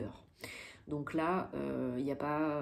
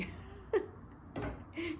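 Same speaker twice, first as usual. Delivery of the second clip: high unvoiced giggle; a quick airy laugh; breathless laugh; 13 semitones higher, 5 dB quieter than usual. Real, not acted. Joke about the drink. (petit rire soufflé)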